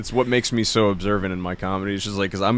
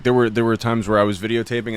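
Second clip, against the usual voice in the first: ordinary talk, nothing marked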